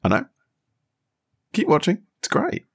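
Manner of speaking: a hint of a smug friend who knows; friend quote. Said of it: "And he's like".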